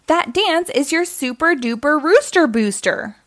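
root for your hero; excited storybook cheer; cheering supporter. A narrator's reading. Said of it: "rooted Rosie".

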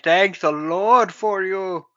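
slow, loud, bad Uruguayan accent; Jon Voight. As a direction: intone it in a hammed-up South American accent. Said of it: "He's like".

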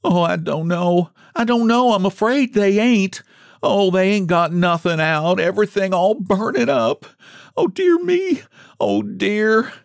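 put-on high, wailing old mother-in-law; distraught lament. A narrator's reading. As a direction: wail helplessly in a high voice. Distraught woman watching her house burn.

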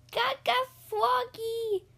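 put-on high, slow baby holler. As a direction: babble it like a toddler. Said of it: "hollered Palawagalina".

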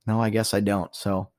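ordinary delivery

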